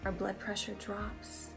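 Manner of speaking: usual speaking voice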